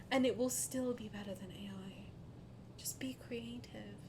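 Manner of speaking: hushed, voiceless whisper; whispered urging. Real, not acted. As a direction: whisper it as earnest advice. Drops to whispered encouragement.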